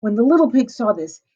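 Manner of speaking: usual speaking voice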